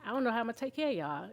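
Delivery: soft, quick, subdued admission; retold helplessness. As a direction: confess it quietly to your child, understated. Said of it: "caught me crying".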